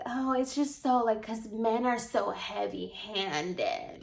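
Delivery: drawn-out, softened mock complaint; put-on fussy client, slowing on the last words; mocking client imitation. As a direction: drag it out as a mocking quote of her.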